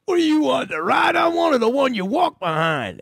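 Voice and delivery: mock yell. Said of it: "I said".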